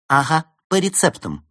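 едко и громко, с высоким тоном; бросить колкость свысока; язвительно. A narrator's reading. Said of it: "язвительно бросила Берта". (язвительная насмешка)

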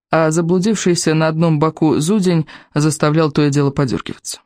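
ordinary delivery

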